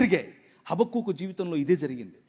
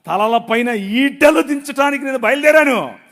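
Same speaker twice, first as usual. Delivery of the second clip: proclaiming God's word; 2 semitones higher, 9 dB louder than usual. Acted. Voicing God's declaration.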